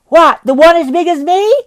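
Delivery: put-on high, bright young lad's voice; a cocky boy calling up to the window; cheeky street boy. Boy replies.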